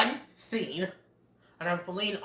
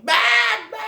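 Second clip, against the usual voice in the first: much higher, louder squawk; cawing crows; 9 dB louder than usual. Retold or imitated. Crows mocking Bambi, squawking cry. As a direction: caw it like a crow. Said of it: "crows swoop in, saying".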